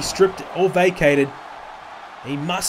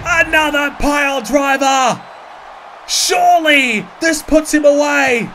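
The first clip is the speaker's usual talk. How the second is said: high, loud, frantic ringside call; wrestling commentator; 8 semitones higher, 6 dB louder, slower than usual. Acted. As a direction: scream the big moves like a live announcer. Live wrestling play-by-play.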